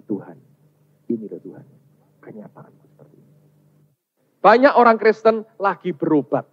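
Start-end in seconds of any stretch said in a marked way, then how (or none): 0.1-3.3 soft, low prayer; humble confession. Retold or imitated. Preacher recalling confessing the truth to God, soft low admission. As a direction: admit it to God, sinking almost to breath. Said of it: "Saya ngaku".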